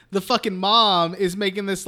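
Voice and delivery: ordinary delivery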